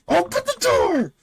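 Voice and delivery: very high strained scream, not full volume; desperate falsetto shriek at the door. Acted. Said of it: "kind of screaming at Demons".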